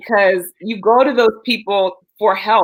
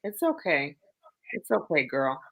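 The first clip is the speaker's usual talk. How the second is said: softer, hushed reassurance; soft comfort; 6 semitones lower, 11 dB quieter than usual. Real, not acted. Friend hushing a tearful friend, softly reassuring. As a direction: soothe her gently.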